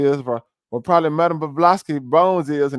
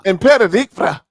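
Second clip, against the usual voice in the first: louder, higher parroting of the guest; mocking echo; 4 semitones higher, 6 dB louder than usual. Host repeats guest's birthplace back at him, mocking echo. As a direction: throw the foreign name back, punched up.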